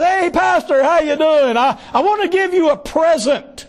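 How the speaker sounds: cheery borrower. Retold or imitated. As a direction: sing it out like an eager debtor.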